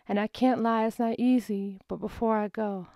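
ordinary talk, nothing marked

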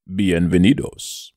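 deep, slow Spanish showing-off voice; confident Spanish voice. Pretend. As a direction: announce it slowly in a deep, self-assured Spanish voice. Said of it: "said it in his confident, deep Spanish voice".

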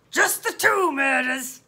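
slow, gruff police question; loud officer, drawing it out sceptically; gruff and slow, querying her; doubting policeman. Acted.

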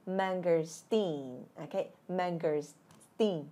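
"mangosteen" drawn out, pause before a long steen; slow model. Shown as a demonstration. Teacher repeating the fruit name for learners, slow model. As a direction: stretch "mangosteen" syllable by syllable.

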